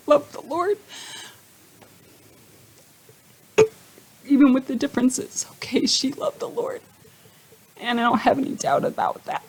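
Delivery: fighting back tears while speaking; real choked-up emotion, breaking into a laugh. Real, not acted. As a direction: voice catching high with tears. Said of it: "I get choked up a lot".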